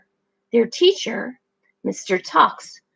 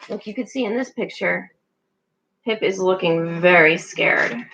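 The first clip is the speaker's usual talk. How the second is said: ordinary delivery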